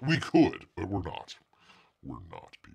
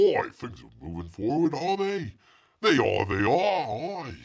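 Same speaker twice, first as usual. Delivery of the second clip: put-on lower class English accent. Host redoing the comment in a mock lower class English accent. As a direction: ham up a rough London accent. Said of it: "lower class English".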